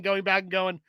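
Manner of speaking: ordinary delivery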